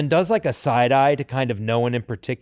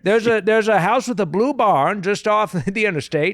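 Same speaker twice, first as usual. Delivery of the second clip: put-on high, folksy Maine Yankee accent; joking that Rogue might sound like an old Maine man; 6 semitones higher than usual. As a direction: play an old Maine local, hammed up. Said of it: "State of Maine Rogue".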